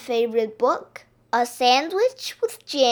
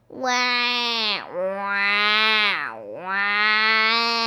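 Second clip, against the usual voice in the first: long, held mock baby wail; stretched-out storybook bawling; baby crying wail; much slower than usual. A narrator's reading. Little sister left behind at school, wailing. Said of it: "But Hot Stuff cried".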